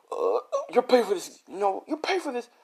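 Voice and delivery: high-pitched, panicky empty threat; hammed-up, squeaky retreating threat; scared threat. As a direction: play a frightened bully backing off. Scared gang threatens while retreating.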